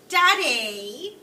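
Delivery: put-on high, loud spoiled-girl wail of Daddy; whiny Daddy. Parent reading, mimicking spoiled Veruca's demanding call. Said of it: "she would always go".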